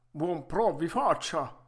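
vecchietto arzillo, voce forte e più alta; Geppetto arzillo. Acted. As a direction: saluta forte, da vecchietto pimpante. (vecchietto arzillo a voce alta)

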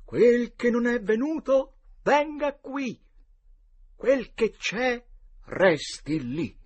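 solemn chant, high and slow; formula solenne. A narrator's reading. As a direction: intone the spell like a ritual. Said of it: "recitò solennemente questa formula magica". (formula magica recitata solennemente)

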